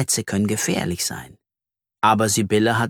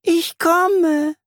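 very high singsong answer; high, thin voice; 3 dB louder than usual. A narrator's reading. Sibylle the spider answers from her web, high-voiced. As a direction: answer in a high, drawn-out little voice. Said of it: "Eine helle Stimme wispert".

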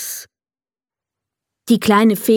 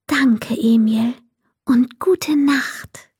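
whispered goodnight; 3 semitones higher, slower than usual. Acted. Fairy says goodnight to Emil, slow whisper. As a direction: tiptoe words so he stays asleep.

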